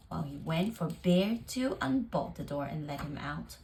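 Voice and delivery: plain reading voice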